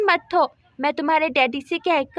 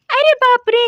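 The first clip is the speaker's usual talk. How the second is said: sudden loud gasp of alarm, pitched higher; shocked exclamation; 6 semitones higher, 6 dB louder than usual. Acted. Neighbour spotting goats in her yard, shocked loud cry. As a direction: blurt it out in shock.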